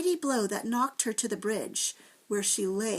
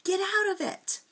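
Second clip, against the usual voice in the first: frightened cry; 10 semitones higher, 3 dB louder than usual. A narrator's reading. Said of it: "Sick with fear".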